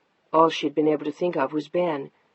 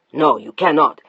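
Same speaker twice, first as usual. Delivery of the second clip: lower, louder stern command; firm refusal; 3 semitones lower, 9 dB louder than usual. Acted. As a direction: lay down the law. Husband forbids firmly.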